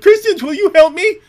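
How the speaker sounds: mock whiny pleading; whiny plea. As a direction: beg for help like a crybaby. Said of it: "I'll post a bunch of tweets to Christians".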